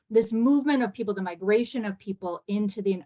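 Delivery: quick, run-on explanation; speaking very quickly. Real, not acted. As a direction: rush through the point without pausing. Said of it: "I realize I'm speaking very quickly".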